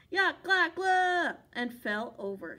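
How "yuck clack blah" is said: loud, higher gagging yell; a wolf choking on spray; wolf yelling yuck. A narrator's reading. Wolf sprayed in the face, loud gagging yell. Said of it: "The wolf yelled".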